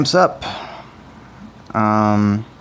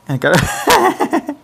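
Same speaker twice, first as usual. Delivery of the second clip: cracking up mid-line, high; real laughter breaking the song; laughing; 6 semitones higher, slower than usual. Real, not acted.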